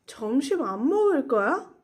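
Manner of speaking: 점심 안 먹을 거야 with a swooping rise at the end; question intonation. Shown as a demonstration. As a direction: ask 점심 안 먹을 거야, lifting the ending. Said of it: "read as questions".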